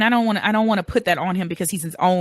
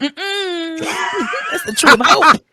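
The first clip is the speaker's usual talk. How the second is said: high, loud laughing correction; laughing shout; 11 semitones higher, 7 dB louder, slower than usual. Real, not acted.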